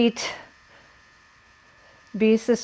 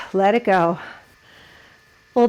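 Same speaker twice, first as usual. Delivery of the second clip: ordinary talk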